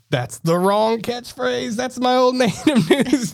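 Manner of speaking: realising he used the wrong catchphrase, laughing; mock horror. Real, not acted. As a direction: catch yourself with a shocked laugh.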